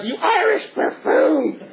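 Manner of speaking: high, slow puppet insult; indignant Punch. Acted. Punch insults the crocodile before braining him, high mock outrage. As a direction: hurl a hammed-up insult.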